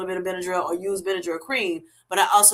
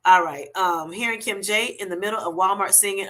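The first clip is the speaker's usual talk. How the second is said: usual speaking voice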